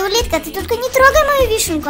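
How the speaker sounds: ordinary talk, nothing marked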